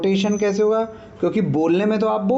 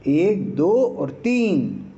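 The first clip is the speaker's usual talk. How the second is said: very slow, each count stretched; counting slowly; much slower than usual. Shown as a demonstration. Teacher counting out vowel lengths slowly. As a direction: count एक, दो और तीन out drawn long. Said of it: "जो जोर की आवाज़ होती है".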